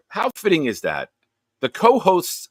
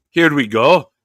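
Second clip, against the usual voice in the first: a loud, higher, hammed-up Canadian "Here we go"; poor Canadian accent; 4 semitones higher, 8 dB louder than usual. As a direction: fake Canadian cheer, louder and higher. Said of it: "Nick can do a poor Canadian accent".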